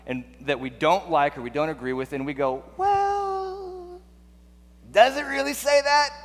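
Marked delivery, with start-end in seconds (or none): 2.8-6.1 very high, squeaky doubting voice; skeptical whine. Acted. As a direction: draw out a high falsetto "well", then question it in squeaky disbelief. Mimics reluctant Bible readers doubting Scripture.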